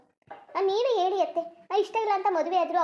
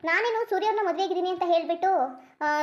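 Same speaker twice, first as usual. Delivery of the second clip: ordinary delivery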